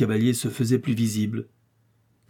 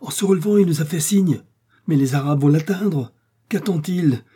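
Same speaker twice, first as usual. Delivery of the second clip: high, raised-voice running report; urgent excitement; 6 semitones higher, 4 dB louder than usual. A narrator's reading. Watchers seeing Arabs close in on Joey, urgent excitement. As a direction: report it urgently, pitched well up. Watching friend nearly caught.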